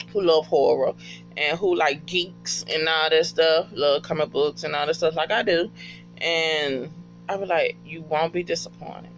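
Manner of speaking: ordinary talk, nothing marked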